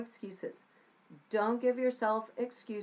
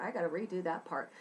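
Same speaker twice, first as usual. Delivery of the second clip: rattled off quickly under the breath; fast self-note; 3 semitones lower, 5 dB quieter, faster than usual. Real, not acted. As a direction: rush it like a quick note to yourself. Same self-note, repeated faster.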